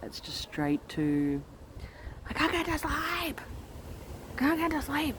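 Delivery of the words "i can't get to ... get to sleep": put-on high, whining child; whiny child voice. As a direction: whine like an overtired kid. Mother imitating daughter's whine.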